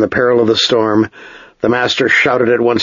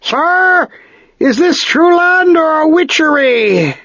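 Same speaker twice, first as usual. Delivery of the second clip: much higher, slow, awed call; calling steersman; slower than usual. A narrator's reading. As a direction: call out in wonder to the captain. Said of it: "turned his head and called".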